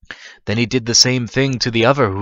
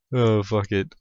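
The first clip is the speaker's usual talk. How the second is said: usual speaking voice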